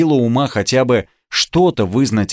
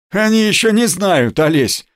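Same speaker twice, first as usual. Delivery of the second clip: higher-pitched snide jibe; lightly mocking, pitched up; 9 semitones higher than usual. A narrator's reading. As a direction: toss it out with a sly needle. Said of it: "бросил кто-то ехидно".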